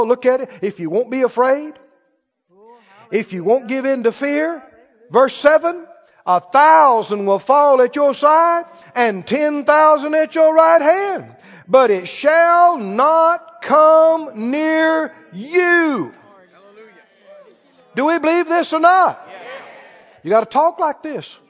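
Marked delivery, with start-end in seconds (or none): 6.3-16.1 slow, loud scripture declaration; emphatic proclamation. Real, not acted. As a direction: stretch out every word of the promise. Proclaiming the verse with force.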